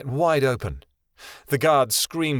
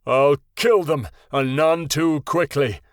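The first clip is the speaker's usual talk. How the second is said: louder, slow, menacing; murderous threat; 2 semitones higher, 4 dB louder, slower than usual. A narrator's reading. Dragon baring fangs at the guards, slow menacing threat. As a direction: promise slow revenge.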